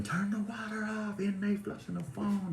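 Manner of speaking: shrieky send-up of a guard's alarm; imitated yelling. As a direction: mimic a guard calling down the tier. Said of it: "She's yelling".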